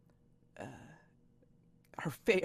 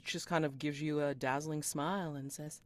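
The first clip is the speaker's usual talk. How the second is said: ordinary delivery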